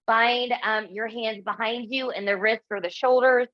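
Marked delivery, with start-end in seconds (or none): none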